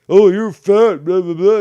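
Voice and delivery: loud, high parody of a heckler; mocking insult. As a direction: toss it off as a loud mock taunt. Mocks the insults against Ken.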